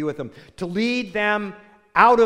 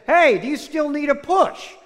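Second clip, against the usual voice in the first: higher, louder acted call into the dark; 8 semitones higher, 5 dB louder than usual. Husband calls to unseen stranger, raised acted call. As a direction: call out to someone out of sight.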